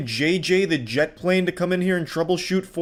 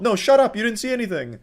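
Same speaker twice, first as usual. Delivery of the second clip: higher, mocking snap back; mock angry retort; 8 semitones higher, faster than usual. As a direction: snap at the crowd like an angry Karen. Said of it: "You know".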